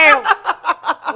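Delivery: loud, high laugh; bright laughing burst; burst of laughter. Real, not acted. Laughs at the trick game.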